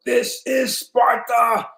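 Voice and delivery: high, slow, drawn-out mock movie line; quoting the Sparta movie, hammed up; movie quote. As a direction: stretch it like the famous film line. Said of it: "I hear all kind of stuff".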